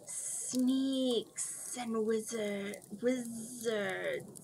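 very slow, soft whisper. A narrator's reading. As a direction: draw it out in a whisper. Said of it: "whispered the soft voice".

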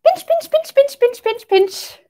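high squeaky crab pinching, falling down; pretend crab. Teacher pretending to be a crab for kids, high and playful. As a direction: squeak it like a little crab snapping. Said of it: "I think I would say".

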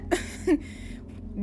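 high, breath-only chuckle; small real laugh; soft laugh. Real, not acted. Player pleased the game saved, soft laugh. Own laugh after celebrating save.

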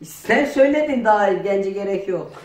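usual speaking voice

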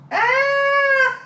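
long, high, loud squeal; drawn-out celebratory scream; excited scream. Real, not acted. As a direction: squeal as the surprise is revealed. Birthday surprise reveal.

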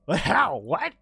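sudden high, loud disbelief; surprised. Real, not acted. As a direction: blurt it out in shock. Boss fight surprise.

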